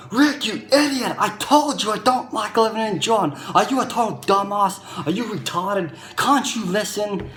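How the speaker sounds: high-pitched angry outburst, reliving it; retold angry rant. Yelling at friend Rick on the phone over a gift, high and furious. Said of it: "I am just so angry".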